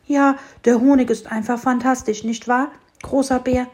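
eager sparrow. A narrator's reading. Sparrow greets bear.

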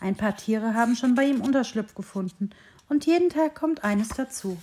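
ordinary delivery, nothing marked

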